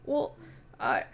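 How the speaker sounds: lightly voiced fae knight, worried; a hint of a fretful knight. Knight explains the hags to the party, lightly worried.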